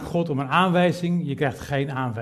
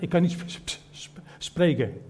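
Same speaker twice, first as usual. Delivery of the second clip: stammering on sp-, slowing; mock stutter on spreken; 2 semitones higher than usual. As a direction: Moses stuck on the word. Moses stutters the word.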